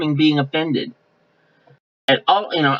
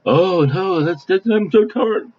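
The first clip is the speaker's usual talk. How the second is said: higher, slower put-upon whine; mock whiny complaint; 4 semitones higher, slower than usual. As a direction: play the easily offended sigh, higher and drawn out. Preacher mocks easily offended person.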